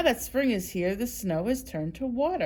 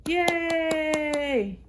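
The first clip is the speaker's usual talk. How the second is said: cheer; 8 semitones higher, 3 dB louder than usual. Real, not acted. Cheering after the song.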